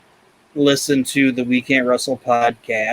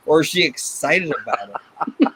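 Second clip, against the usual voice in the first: ordinary talk, nothing marked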